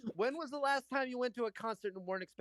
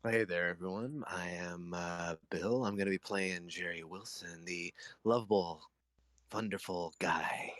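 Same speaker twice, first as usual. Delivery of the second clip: deep put-on voice; 11 semitones lower than usual. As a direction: drop the voice way down, hammy. Introduces self in put-on voice.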